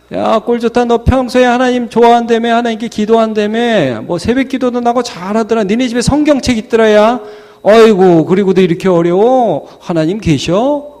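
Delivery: high, sing-out taunting; mocking. Onlookers mocking a struggling believer's faith, taunting. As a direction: jeer as a scornful onlooker. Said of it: "조롱을 하는".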